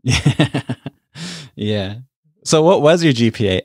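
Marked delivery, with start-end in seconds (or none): 2.4-3.6 playful, higher and louder teasing question. Real, not acted. Host teases the guest about her grades, teasing. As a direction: poke fun with a cheeky question. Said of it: "I'm just kidding".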